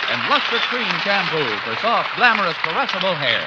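loud, fast closing-sponsor rush; rushed announcer plug. Acted. Announcer to the radio audience, a loud, rushed sponsor plug. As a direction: race through the sponsor plug at full volume. Closing ad read over music.

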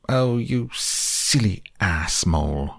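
high, slow, exasperated outburst; exasperated cry. Acted. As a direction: cry out at him, fed up. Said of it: "cried the toad".

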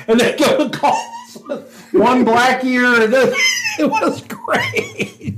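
real high laughter stretching the words slowly; laughing through words. Real, not acted. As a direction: struggle to finish the story while cracking up. Cracking up at own prank memory.

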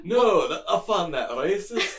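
loud, high, badly faked Scottish accent; mock-offended in a weird Scottish accent. Visitor objecting to the commissioner, weird Scottish accent. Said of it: "weird Scottish accent".

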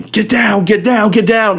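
high shouted orders, repeated; retold police shout. Task force officers ordering everyone to the floor, shouting high. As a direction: yell it like an officer storming in.

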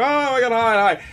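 high, bright squeal of hello; excited greeting. Retold or imitated. Fans on the stairs greeting the host, excited squeal. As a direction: greet them like thrilled fans. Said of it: "They go".

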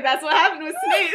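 loud, high words through laughter; cracking up at full volume; helpless laughter. Retold or imitated. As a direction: barely get the punchline out for laughing.

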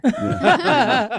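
high, laughing yeah. Real, not acted. Laughs at the axe murderer joke.

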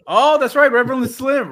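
high, loud welcome; excited greeting. Real, not acted. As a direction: announce the new member excitedly. Greeting a new member.

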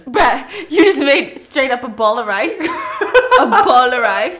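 the last words stretched out slowly while cracking up; laughing. Real, not acted. Teasing friend's rice ball.

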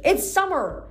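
high astonished protest; exclaiming. A narrator's reading. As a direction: Piper exclaims.

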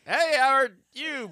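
high, loud, slow mock greeting; high silly greeting. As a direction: greet him in a goofy high voice. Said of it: "'cause I'm like".

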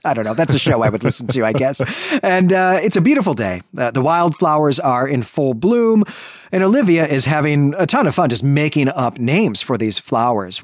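normal talking voice

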